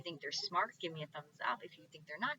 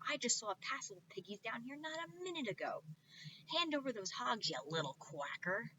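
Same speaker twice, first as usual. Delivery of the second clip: higher angry witch voice; 6 semitones higher than usual. Acted. Witch Gritch demanding hogs from the duck, mildly angry. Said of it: "Gritch screeched".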